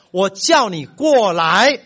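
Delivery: loud, slow, drawn-out order; slow angry command. Retold or imitated. Father calling his son a third time, slow loud command. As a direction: spell each word out, furious.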